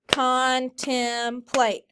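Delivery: slow, syllable by syllable; clapped syllables. Shown as a demonstration. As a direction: clap out each beat of Contemplate as con-tem-plate. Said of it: "Clap it".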